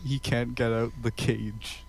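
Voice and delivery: normal talking voice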